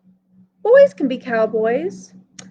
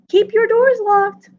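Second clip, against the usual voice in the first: higher and louder, a mother calling after him; 8 semitones higher, 4 dB louder than usual. Acted. Mom calls after her son leaving home, loud. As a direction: call the warning out as he drives off. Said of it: "Mom called".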